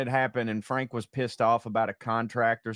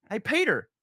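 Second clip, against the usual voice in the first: casual Jesus; 9 semitones higher than usual. Acted. Punchline, Jesus on cross.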